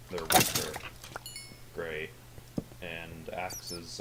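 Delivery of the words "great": normal talking voice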